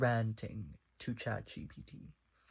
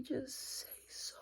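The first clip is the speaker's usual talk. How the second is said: slow, high breathy whisper; teasing whisper; 14 semitones higher, 8 dB quieter than usual. Retold or imitated. Quoting her flirty dare to him, a slow high whisper. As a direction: whisper it slowly and coyly, pitched up.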